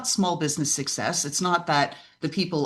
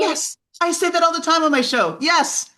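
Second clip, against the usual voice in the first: much higher and louder, quick eager yes; excited agreement; 12 semitones higher, 6 dB louder than usual. Real, not acted. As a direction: jump in, thrilled to agree.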